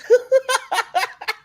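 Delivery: very high laugh; high squealing laugh. Real, not acted. Laughing after mock ace call.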